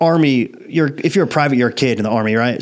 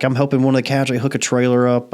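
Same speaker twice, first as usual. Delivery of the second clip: ordinary talk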